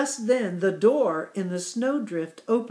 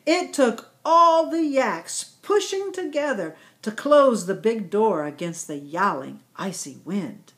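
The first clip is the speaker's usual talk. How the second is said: ordinary delivery, nothing marked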